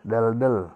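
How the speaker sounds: deldel said dəldəl with short schwas; schwa pronunciation. Shown as a demonstration. Teacher explains missing E, then says deldel clearly. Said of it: "walaupun tidak ada E di sini ya".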